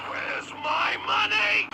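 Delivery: slow, high, loud shout; angry demand. Acted. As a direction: demand it, drawing out each word.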